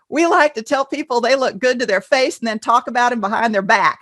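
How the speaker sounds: hammed-up Southern US accent; generic Southern accent. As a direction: quote the Southern client in their accent. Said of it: "only do a generic Southern one".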